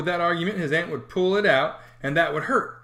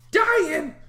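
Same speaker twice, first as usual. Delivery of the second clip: high, loud shocked question; aunt startled by news; alarmed aunt's cry; 5 dB louder than usual. Acted.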